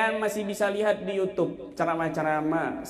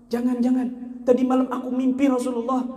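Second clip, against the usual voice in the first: debtor's voice; 7 semitones higher than usual. Acted. Debtor tells of his dream.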